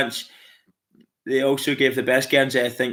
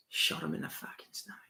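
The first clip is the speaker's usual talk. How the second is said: whisper; 3 semitones higher, 19 dB quieter than usual. Retold or imitated. Recalling a Chopper Read line.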